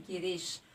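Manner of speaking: teacher's unforced demo of गिरीश; unforced long vowel. Shown as a demonstration. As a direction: let गिरीश drop out in one easy go. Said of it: "जिसका उच्चारण एकदम से हो रहा है".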